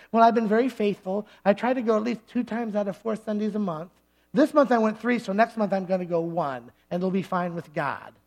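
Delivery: lightly voiced self-satisfied church member; complacent churchgoer. Churchgoer tallying attendance for the pastor, complacent.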